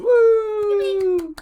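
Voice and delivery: high excited cheer; excited whoop. Real, not acted. GM cheers after combat.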